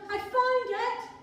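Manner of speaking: a shepherd bursting with joy; overjoyed cry. Pretend. Shepherd to his neighbours, a loud overjoyed cry. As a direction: shout it out high and thrilled. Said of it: "that's an overreaction".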